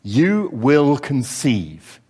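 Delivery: very slow, weighty reveal; the angel unveils the news, slow and solemn; big reveal. Acted. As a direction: draw out each word of the announcement. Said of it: "the big reveal".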